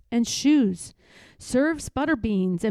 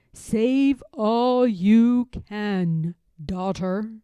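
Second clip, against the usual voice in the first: voice of God; 6 dB louder, much slower than usual. Acted. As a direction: assure her solemnly. God's charge.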